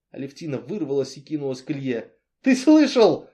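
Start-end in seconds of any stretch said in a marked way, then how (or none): 2.4-3.3 high, loud shout of outrage. A narrator's reading. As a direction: yell it at him, beside herself. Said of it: "закричала она".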